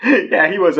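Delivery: laughing through the words. Real, not acted. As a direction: chuckle as you say it. Amused telling about gullible client.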